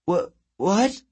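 high, stuttered disbelief; baffled. A narrator's reading. Girl told there are no plans, baffled. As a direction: stutter in startled confusion.

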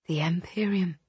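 soft, breathless whisper; awed whisper. A narrator's reading. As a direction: whisper it in wonder. Awed discovery in the sea.

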